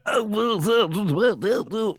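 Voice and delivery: high imitated nonsense woo-woos; Bill Cosby. Host mimics the comedian's sounds, goofy high hooting. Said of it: "Those stupid sounds he used to make".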